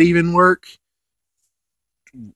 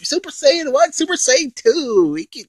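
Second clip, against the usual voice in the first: much higher, thin dismissive villain voice; mocking Babidi; 12 semitones higher than usual. Babidi brushing off Goku's transformations, high mocking voice. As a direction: sneer it off in a high squeak. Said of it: "Babidi's like".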